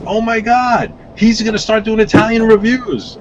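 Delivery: high, excited gushing voice; excited Italian fans. Retold or imitated. Italian fans reacting to his Italian posts, excited and high. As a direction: gush like a thrilled fan. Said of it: "they're like".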